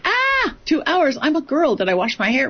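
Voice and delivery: put-on high, panicked girly fluster at the sudden rush; flustered surprise. A woman caught off guard by a call, flustered and high. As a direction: squeal it like there's no time to get ready.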